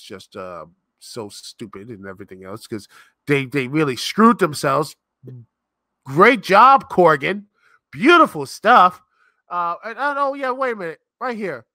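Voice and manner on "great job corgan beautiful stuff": sarcastic praise. Real, not acted.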